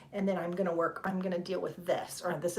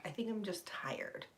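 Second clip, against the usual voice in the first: tired whispered confession; 12 dB quieter than usual. Real, not acted. Streamer admitting to viewers she's tired, whispered. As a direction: breathe it out, worn out.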